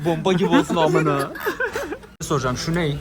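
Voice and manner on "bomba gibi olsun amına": loud, laughter breaking into the words; laughing. Real, not acted. Retelling the friend's joke order, laughing. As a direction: giggle through the punchline.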